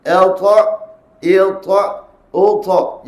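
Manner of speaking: ta loud and bounced, thick; correct qalqalah ta. Shown as a demonstration. Teacher recites the correct ta again. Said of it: "Dia ikut sifat huruf tersebut".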